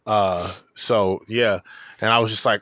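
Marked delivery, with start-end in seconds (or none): none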